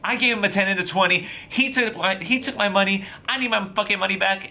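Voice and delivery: high-pitched angry demand, acted out; legit pissed. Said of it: "like, legit pissed".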